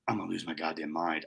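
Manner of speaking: low, quiet seething aside; fed-up inner voice. Retold or imitated. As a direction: grumble it to yourself, low and soft. Fed-up inner thought in story.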